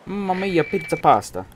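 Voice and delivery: high, loud mock Italian exclamation; jolly italian pizza chef. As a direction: belt it like a cartoon pizza chef. Streamer jokes in Italian voice.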